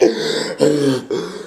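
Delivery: heavy breathless gasp. Shown as a demonstration. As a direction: suck in air, exhausted. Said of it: "'cause I'm out of breath".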